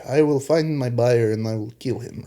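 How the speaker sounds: bad Russian accent; hammy Russian villain accent. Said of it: "Or I said that in a Russian accent. That's terrible".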